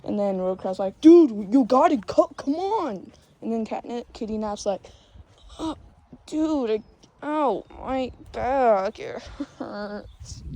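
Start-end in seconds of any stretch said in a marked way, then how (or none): 1.0-3.1 loud, high exasperated pleading; raised, mock-impatient character voice; exasperated urging. Voices Road Crab urging. 5.6-10.4 slow, strained hurt groan; pretend pain. Voices injured Cat Kitty Nap.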